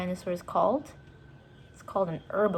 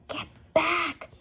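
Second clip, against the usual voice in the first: whispered; 10 semitones higher than usual. A narrator's reading. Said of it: "whispered Jack".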